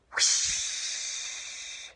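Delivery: long airy whoosh sound; breathy whoosh. Shown as a demonstration. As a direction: blow it out like wind. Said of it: "One more time".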